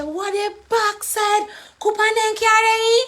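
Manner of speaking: high, shouted patois exclamation; startled Jamaican shout; loud Jamaican patois outburst. Acted. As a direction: yell out in shock in thick Jamaican patois. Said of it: "You weren't talking like that".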